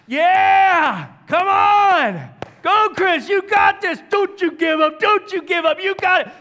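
high, loud shouted cheers; crowd cheering. Retold or imitated. Gym crowd cheering the exhausted pastor to the finish, loud shouting. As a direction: yell it like a gym crowd urging a runner on. They start clapping.

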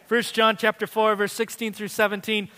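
loud, lifted preacher's voice with long pauses; full-force preaching. Acted. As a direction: preach it out over the congregation.

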